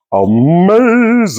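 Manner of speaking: high, slow "amazing"; drawn-out amazed. Real, not acted. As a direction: stretch it out in awe. Admiring the crust.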